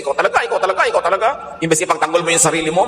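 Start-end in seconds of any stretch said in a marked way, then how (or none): 0.0-1.3 higher, nagging accusation; mock angry accusation. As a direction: jab the repeated words like a fed-up neighbour. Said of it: "May galit na galit sa'yo".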